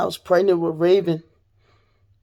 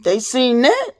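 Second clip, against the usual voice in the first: heated rant; 7 semitones higher than usual. Real, not acted. Telling viewers about witnesses who saw the gun, heated higher rant. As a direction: vent hard about the people who doubted her.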